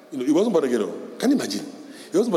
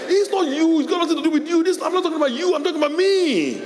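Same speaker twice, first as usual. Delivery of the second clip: mother protesting; 13 semitones higher than usual. Retold or imitated. Mother telling her child it's not about him, high protest. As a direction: insist it's not about them, pitched up.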